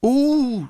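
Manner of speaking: shrill high squeal of delight; screeched ooh. A narrator's reading. Constanza squealing at the forfeit game, screeched ooh. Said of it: "screeched Constanza".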